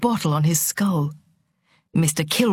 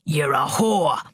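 low, unshouted angry accusation; contained accusation; 3 semitones lower, slower than usual. A narrator's reading. Father accusing his daughter over the table, anger contained. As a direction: accuse her with the anger held in.

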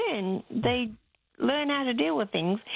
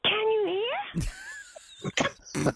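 put-on toddler yelling; 13 semitones higher, much slower than usual. Toddler checking if blind Nana can hear, high slow mock yell. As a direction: stretch it out like a child shouting to a deaf grandma. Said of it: "she yelled at me".

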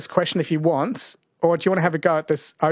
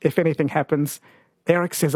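ordinary delivery

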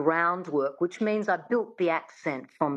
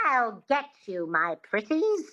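Wicked Witch; 2 semitones higher than usual. Shown as a demonstration. Coach modelling for the student to repeat, Wicked Witch voice. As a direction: put on a twangy witch's threat. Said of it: "Think of the Wicked Witch".

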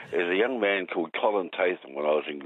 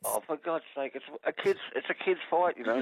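exasperated protest; 10 semitones higher, 3 dB quieter than usual. Real, not acted. Caller protests host's rebuke.

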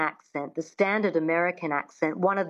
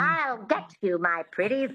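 Wicked Witch; 3 semitones higher than usual. Shown as a demonstration. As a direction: draw it out with a witchy twang. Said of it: "that twang is really important".

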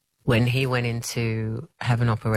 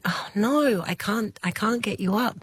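dismay; 10 semitones higher than usual. Retold or imitated. Dad cannot fly in dream.

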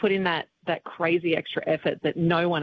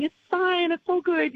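much higher, light brushing-off; embarrassed reassurance; 13 semitones higher than usual. Retold or imitated. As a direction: wave it off in a high sheepish voice. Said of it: "I was in the audience going".

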